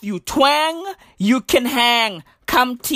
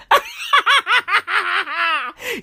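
long high-pitched cackle; high laugh; 8 semitones higher than usual. Real, not acted. Laughs at own Segway joke.

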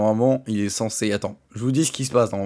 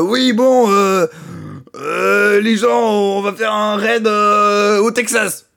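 higher, louder, slow hammed-up rough voice; gruff trucker lady; 8 semitones higher, 5 dB louder, much slower than usual. Mocking Nami's dub as a rough off-road driver announcing a raid. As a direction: ham up a rowdy four-by-four driver rallying the crowd. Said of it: "elle a une voix de chartier".

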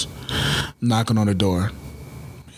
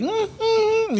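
put-on baby whimper. Imitating baby's whimper.

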